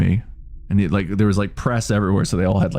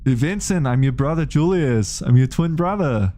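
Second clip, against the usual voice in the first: high comedy Schwarzenegger voice; comedy Arnold as Julius; 6 semitones higher than usual. Retold or imitated. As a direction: ham up Arnold playing Julius. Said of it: "I can do a, a Julius".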